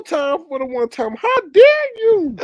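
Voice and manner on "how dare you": very high, slow, loud how dare you; mock offended. Vlogger hamming up indignation, slow and high. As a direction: squeal it like a scandalized diva.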